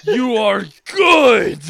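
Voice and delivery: hammed-up, drawn-out loud praise; Guy Sensei. Sensei praising his student Lee, hammed-up shout. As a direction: build to a slow, booming shout. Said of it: "And Guy goes".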